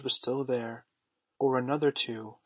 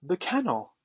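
put-on higher-pitched boyish exclamation; excited cry; 6 semitones higher, 3 dB louder than usual. A narrator's reading. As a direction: call out a sudden discovery. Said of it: "cried John".

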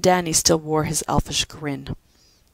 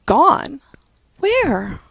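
shocked; 10 semitones higher, 6 dB louder than usual. Acted. Pat shocked by the news.

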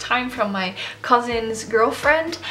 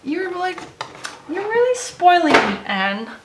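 gushing thanks; 4 semitones higher, slower than usual. Real, not acted. Thanking the gift sender.

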